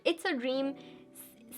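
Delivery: normal talking voice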